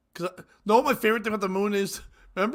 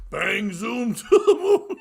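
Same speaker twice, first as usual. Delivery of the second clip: loud, slow sitcom catchphrase; hammed-up bluster, pitched up; mock catchphrase; 4 semitones higher, 7 dB louder, slower than usual. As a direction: draw it out like a comic threat. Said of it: "And she's like".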